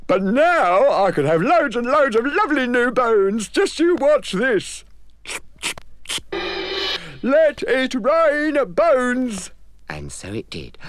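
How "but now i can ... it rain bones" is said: high, cheerful creature voice; trusting Moog. Acted. The Moog thanking the godmother, high and happy. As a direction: innocent and pleased.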